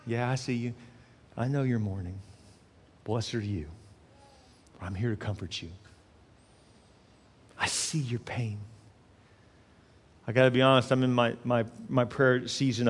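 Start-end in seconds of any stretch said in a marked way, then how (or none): 0.1-8.6 soft, low, unhurried reassurance; gentle consolation. Acted. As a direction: speak softly to one grieving listener. Jesus consoling a mourner.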